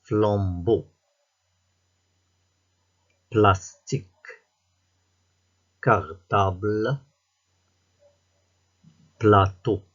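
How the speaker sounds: each word called out loud and apart; slow loud dictation. Shown as a demonstration. Teacher dictating words to pupils, loud and spaced. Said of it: "Écoute et repère le son".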